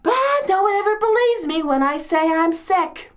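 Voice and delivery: high-pitched put-upon lament; self-pitying complaint. Acted. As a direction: complain like a long-suffering invalid. Complains of being a sick woman.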